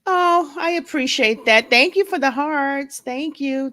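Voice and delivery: light up over the gift; delighted thanks. Real, not acted. Thanking viewers for hearts, high and delighted.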